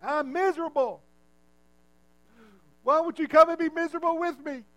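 mock a miserable person begging for company; whiny mock complaint. Mocking people who drag others down.

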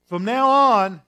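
from now on called out loud and high; proclaiming loudly. Retold or imitated. Jesus calling the fishermen, loud proclamation. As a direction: announce it like a proclamation.

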